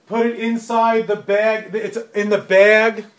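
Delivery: put-on over-articulate it as if to a child; loud and slow. Shown as a demonstration. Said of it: "they talk really loud and slowly".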